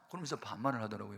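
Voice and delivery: ordinary talk, nothing marked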